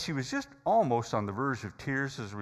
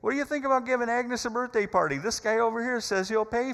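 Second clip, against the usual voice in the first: higher, louder call across the diner; yelling to wife; 14 semitones higher, 7 dB louder than usual. Retold or imitated. Counter guy calls out to his wife, loud and high. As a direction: shout it back to the kitchen. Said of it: "the guy behind the counter yells to his wife".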